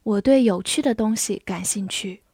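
ordinary talk, nothing marked

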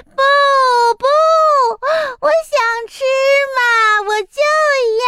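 put-on high, wheedling child's whine; whining tantrum. A narrator's reading. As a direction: insist like a spoiled toddler. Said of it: "鸡蛋妹妹又哭了起来".

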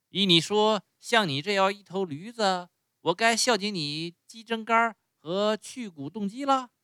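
mock the donkey's demands with rising anger; sarcastic angry master. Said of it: "主人说着更加生气". (主人越说越气，高声讥讽驴子)

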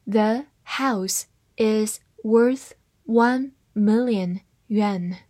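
slow, careful reading of "The house is worth one million yuan"; slowed-down model sentence. Shown as a demonstration. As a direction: read it slowly word by word. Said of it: "跟我慢读一遍".

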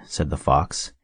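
ordinary delivery, nothing marked

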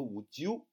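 "would you" run together as one word, with a j sound in the middle; linked "would you". Shown as a demonstration. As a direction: blend "would you" into "wu-jou". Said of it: "这个连读would you".

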